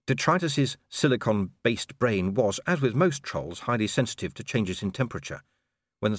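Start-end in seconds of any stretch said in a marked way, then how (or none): none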